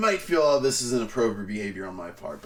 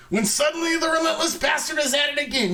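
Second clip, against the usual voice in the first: much higher, louder and fast; sudden burst; 13 semitones higher, 4 dB louder, faster than usual. Acted. Comic describing the director striking again, bursting out excitedly. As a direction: burst out, racing and shrill with excitement.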